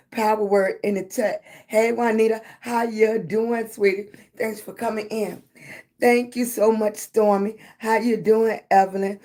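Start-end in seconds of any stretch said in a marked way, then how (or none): none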